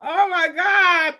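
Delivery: choking up, voice rising with real tears; fighting back happy tears, breaking high; tearful. Real, not acted. Host moved by the authors' thank-yous, tearful and high. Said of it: "you guys are making me cry".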